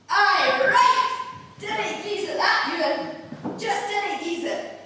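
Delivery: loud, high broad Scottish wife scolding; furious Kate O'Shanter. Acted. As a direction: tear into the drunk husband in broad Scots. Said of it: "Just as I imagined Kate O'Shanter did".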